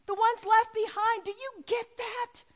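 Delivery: loud, high-pitched sermon climax; impassioned preaching. Real, not acted. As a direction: preach it out with full force. Preaches at full force.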